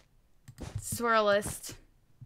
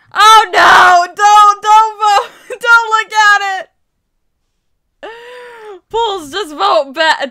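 loud, slow, half-laughing panicked yelling; panicked shout; 7 semitones higher, 16 dB louder, slower than usual. Real, not acted. Panicking gamer shouts at voters not to look. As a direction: wail it out like your build is doomed.

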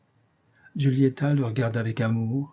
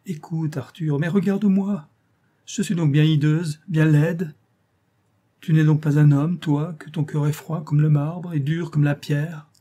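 high, louder anguished pleading, slowing at the end; desperate reproach; 5 semitones higher, 5 dB louder than usual. Acted. Girl reproaching her cold lover, high anguished plea. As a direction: accuse him through tears of a cold heart.

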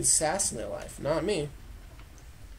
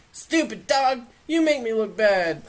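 louder scolding the dog; 6 semitones higher, 4 dB louder than usual. Pretend. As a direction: scold like an annoyed owner.

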